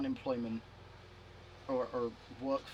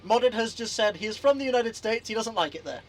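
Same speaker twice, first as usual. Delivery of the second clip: loud, raised voice to another room; calling out; 8 semitones higher, 9 dB louder than usual. Real, not acted. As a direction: call it out so mum hears. Shouting news to mum.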